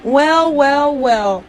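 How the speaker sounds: mock stern. Catching the dog out.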